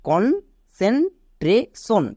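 Concentration split into slow separate syllables; teacher spelling out each part. Shown as a demonstration. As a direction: break concentration up, very slowly. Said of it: "এবার একে একসাথে উচ্চারণ করো".

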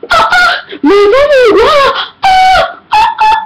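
very high mock scream of grandma; grandma screaming over a dropped bag, hammed-up. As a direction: shriek it like a startled old lady. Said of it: "funny when she screams".

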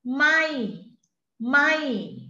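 "Mai" goes up and then comes down; rising tone. Shown as a demonstration. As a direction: sweep "mai" high then let it fall. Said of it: "rising tone". (Mai with a rising Thai tone)

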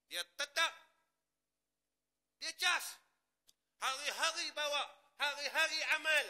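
loud, high, fervent sermon delivery; roaring preacher building to a peak; preaching at full force. Acted. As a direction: drive it home to the congregation. Preacher's charged exhortation.

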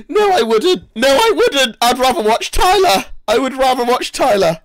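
high, loud joking protest; loud retraction. Real, not acted. Streamer retracts a jab at his friend, loud and high. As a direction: take it back at the top of your voice.

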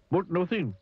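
high, curt grumble; grumpy. Acted. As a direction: cut him off crossly. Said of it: "went on Mister Grumpy".

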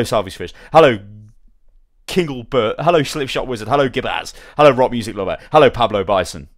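booming hellos, each falling hard; loud chat hellos. Real, not acted. Streamer shouting hellos to each viewer in chat, loud and hearty. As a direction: bellow every name out to the viewers. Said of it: "so I can destroy my voice even more".

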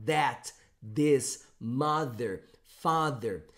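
"That, this, mother, father" with the tongue between the teeth; voiced th words. Shown as a demonstration. Teacher models voiced TH words for students. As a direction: voice every TH slowly. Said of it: "tenho que pôr a língua entre os dentes".

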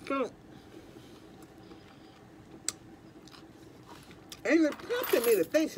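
speaking between bites of wrap; talking while chewing. Real, not acted. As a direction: mouth partly full of food. Seen 0: Bit wrap at -7 to -4; mouth still working while talking.

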